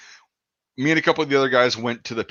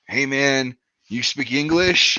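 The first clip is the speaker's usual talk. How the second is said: being a dick; much slower than usual. Retold or imitated. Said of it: "I was being a dick".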